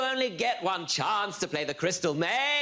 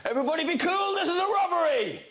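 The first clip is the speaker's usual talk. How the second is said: bank robber; 4 semitones higher, much faster than usual. Acted. Robber bursts in holding up bank.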